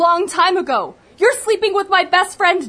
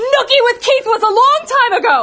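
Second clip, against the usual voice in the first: defensive outburst; 8 semitones higher, 4 dB louder than usual. Acted.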